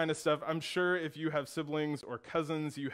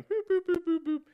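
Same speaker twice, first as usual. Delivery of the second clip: high bouncy boops for each step; running sound effect; 4 dB louder than usual. Shown as a demonstration. Little brother acting out his sprint with boops. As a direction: pitch it up and bounce on every boop. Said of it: "I'll do it like this".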